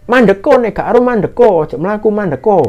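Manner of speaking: high, carrying holler; imitated shouted instruction. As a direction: tell a distant friend to stop. Shouting at lost friend to stop. (menirukan seruan menyuruh berhenti)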